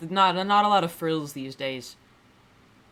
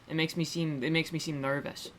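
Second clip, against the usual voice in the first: ordinary talk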